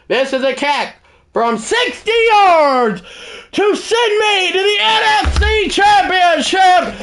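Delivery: high shouted commentary, building to a roar; excited play-by-play call. Real, not acted. Gamer calling his own game-winning field goal, shouting excitedly. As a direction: call the winning kick like a broadcaster.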